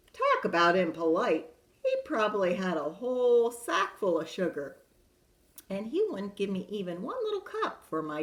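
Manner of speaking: lively wolf narrator telling his side; storyteller wolf. A narrator's reading. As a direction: read it as the sly wolf pleading innocence. Reads wolf's tale in character.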